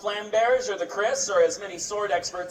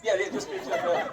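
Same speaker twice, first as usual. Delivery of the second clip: normal talking voice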